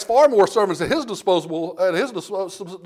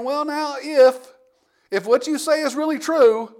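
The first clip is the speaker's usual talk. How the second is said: hesitant, higher-pitched questioning; doubting Gideon; 7 semitones higher, slower than usual. Acted. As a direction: imitate a doubter hedging on "if". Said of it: "maybe he's starting to question God".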